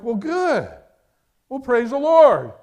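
excited praise. Retold or imitated. Mother healed, son rejoices.

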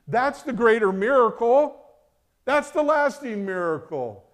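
high, loud sermon climax; full-force preaching. Real, not acted. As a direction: proclaim it at the top of the voice.

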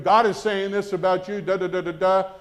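loud, pushy know-it-all declaration; proud, overbearing proclamation; mock brash prophecy. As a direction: announce it with no humility. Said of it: "Instead of going up to him".

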